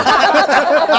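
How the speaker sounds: a loud burst of laughter; real laughter; hearty laugh. Real, not acted. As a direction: laugh out loud, high and bright. Laughing at retold story.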